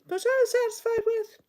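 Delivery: very high, odd little voice; squeaky aside. Acted. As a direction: squeak it out comically.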